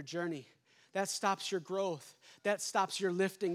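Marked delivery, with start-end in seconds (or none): none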